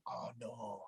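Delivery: very low, hushed groan of dismay; dismayed realisation. Retold or imitated. Boxer reliving house number blunder, dismayed. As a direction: let it sink low as it dawns.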